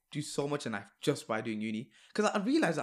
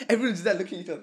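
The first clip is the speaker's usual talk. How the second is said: laughing; 6 semitones higher, 6 dB louder, faster than usual. Real, not acted. Laughing while telling story.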